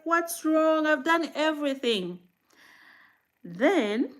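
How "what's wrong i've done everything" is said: high, louder exasperated plea; retold despair at a crying baby, raised; mother retelling her frustration, pitched up and louder; frustrated outburst. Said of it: "there was a night I got frustrated".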